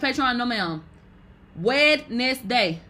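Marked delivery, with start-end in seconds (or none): 1.6-2.8 Wednesday spelled out slowly as Wed-nes-day, every letter said; sounded out. Shown as a demonstration. To chat, teaching how to spell it: sounding out Wed-nes-day slowly. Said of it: "literally sounding it out".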